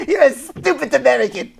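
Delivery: hammed-up French sneer through laughter; mock French accent. Shown as a demonstration. Host repeating the French-accented insult while laughing. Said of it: "your French accent".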